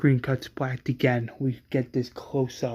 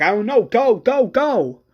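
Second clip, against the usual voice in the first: higher and louder, urging others to go; panicked shout; 7 semitones higher, 6 dB louder than usual. Acted. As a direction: yell it in a panic. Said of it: "Patricia's like".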